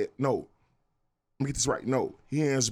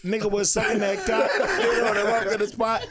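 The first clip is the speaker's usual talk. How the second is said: laughing; 8 semitones higher, 4 dB louder than usual. Real, not acted. Laughing joking chant.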